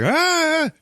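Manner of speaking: loud high-pitched imitated "aah"; hammed-up yell under attack; mock scream.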